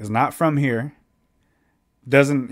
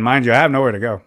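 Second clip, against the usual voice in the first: usual speaking voice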